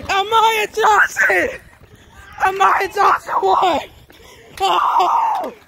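high, excited live race call; sports commentator. Acted. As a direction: call the race like a hyped announcer. Kid does race commentary.

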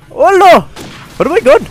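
high-pitched, loud surprise; startled exclamation. Real, not acted. Gamer reacting to the game, startled. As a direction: yelp the words in disbelief.

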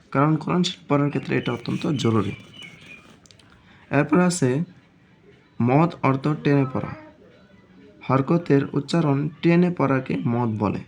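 ordinary talk

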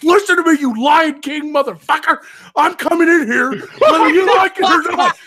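high, full-volume yell of an irate sergeant; retold yelling. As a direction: imitate the sergeant bellowing at the guard. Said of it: "he was yelling at this guy".

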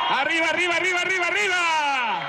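shouted rally cheer; crowd cheer. Acted. Leading crowd applause.

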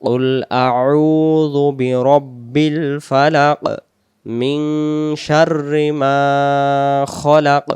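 slow, elongated recitation of an Arabic verse; Quran recitation. Shown as a demonstration. Teacher reciting the first verse for learners, slow recitation. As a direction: stretch the long vowels, measured and full-voiced.

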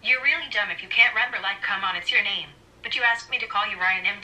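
ordinary talk

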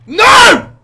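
sudden high, full-volume scream; anguished scream. Real, not acted. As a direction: scream as if you lost it all. Screams at failing in game.

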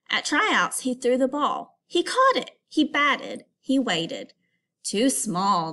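lively read-aloud storytelling; story reading. Acted. Teacher reading a picture book aloud to students. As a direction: read the story to the class with expression.

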